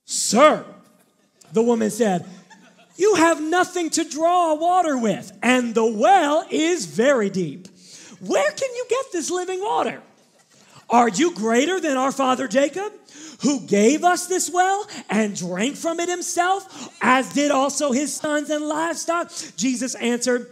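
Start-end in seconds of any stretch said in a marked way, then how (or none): 0.0-0.7 louder sarcastic Sir; a sassy dismissive Sir; loud, mocking address. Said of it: "I got a little bit of sass". 3.0-19.3 louder, higher sarcastic questioning; mock him with sass and a laugh; incredulous sassy woman. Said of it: "I got a little bit of sass".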